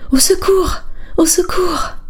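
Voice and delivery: high, piercing cries for help; a woman's frantic scream for help; terrified screams. A narrator's reading. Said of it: "La voix de femme criait effroyablement".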